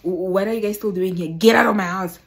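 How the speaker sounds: angry get out. Retold or imitated. Anna throwing the boyfriend's friends out, angry shout. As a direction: order them out of the house.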